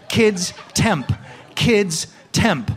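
the customer insisting on his order; repeated shouted order. Retold or imitated. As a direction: yell it slowly as if to a deaf barista. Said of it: "he ordered it kids temp".

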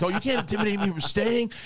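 high, put-on voice of a defiant coach; mocking defiant teacher. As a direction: play the coach scoffing at the threats. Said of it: "and he's like".

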